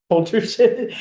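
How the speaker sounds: laughing. Real, not acted. Laughs at own phrase.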